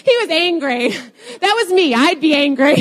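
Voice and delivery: real laughter breaking through high, loud words; amused, giggly outburst; laughing. Real, not acted. Laughing over her own misreading.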